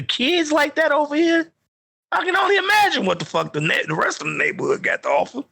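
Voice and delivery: high, fast disbelieving voice; retold inner voice. Retelling his reaction to the neighborhood kids, high and fast. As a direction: pitch it way up in disbelief. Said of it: "I'm like".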